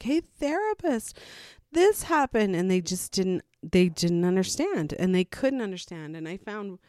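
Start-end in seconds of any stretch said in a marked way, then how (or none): 0.0-2.6 much higher, urgent call for help; eager plea. Retold or imitated. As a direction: reach out hopefully. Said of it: "call therapists and be like".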